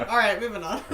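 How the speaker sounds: laughing. Real, not acted. Laughing after awkward moment.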